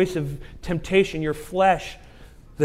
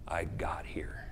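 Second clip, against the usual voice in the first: low, hushed, halting admission; quiet confession; 10 semitones lower, 12 dB quieter, slower than usual. Retold or imitated. Man who had an affair confesses, quiet and low. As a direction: confess it slowly under your breath, voice sinking. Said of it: "you will hear this".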